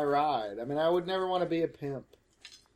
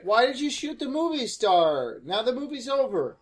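loud complaint; 7 semitones higher, 5 dB louder than usual. Real, not acted. Complaining at the actor.

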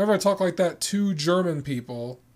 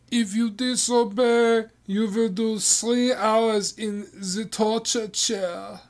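slow, mock-stern German nanny threat, a little higher; strict German nanny; 5 semitones higher, slower than usual. Nanny threatening the children with the chair, mock-stern German accent. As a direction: lay out the punishment like a hammed-up governess. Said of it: "I am Nanny Hilda".

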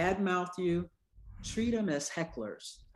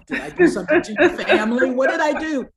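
mock alarm; 8 semitones higher, 12 dB louder, faster than usual. Alarmed reply to recognizing patient.

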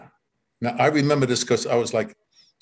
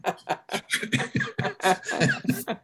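high laugh; 5 dB quieter than usual. Real, not acted. Cracking up at dad's words.

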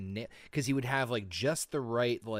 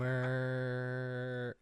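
drawn out; 3 semitones higher than usual. Real, not acted. Stretched-out name, drawn out.

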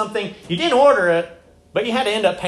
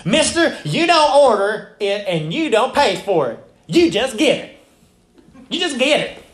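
hammed-up Southern US accent, pitched up at the start and finish; Southern waitress; 3 semitones higher than usual. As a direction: lay down the law like a Southern waitress. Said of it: "'cause, you know, it's the South".

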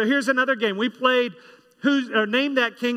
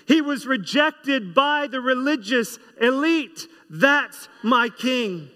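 rising call-and-response sermon climax, slow on the refrains; preaching at full force; 3 semitones higher, slower than usual. Acted. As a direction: build the congregation to a shout of praise.

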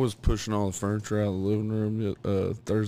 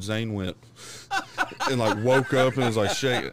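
ordinary talk

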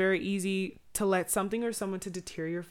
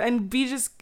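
ordinary delivery

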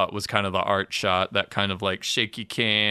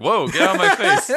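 high, loud mock recoil; startled mock protest; 11 semitones higher, 7 dB louder than usual. Teresa fending off Marissa at the door, mock startled protest. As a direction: jump up in pitch and volume, playing someone pushing back.

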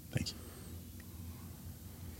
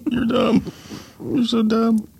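laughing; 14 semitones higher, much slower than usual. Real, not acted. Laughing insult after pun.

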